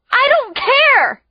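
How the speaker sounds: high, loud, slow defiant scream. A narrator's reading. Girl screaming at her friend, defiant. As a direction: throw it back at him. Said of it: "I scream".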